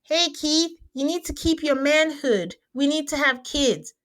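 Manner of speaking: high mock impression of a bride lecturing her husband; mocking Iris. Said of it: "she said".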